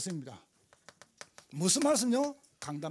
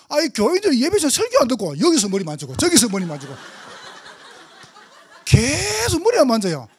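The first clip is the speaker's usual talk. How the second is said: mock complaint; 4 semitones higher, 6 dB louder than usual. Complaining to congregants.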